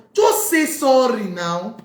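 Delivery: fed-up, emphatic appeal; exasperated plea. Acted. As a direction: plead with mock exasperation, stretching the words. Urging proud parent to apologize.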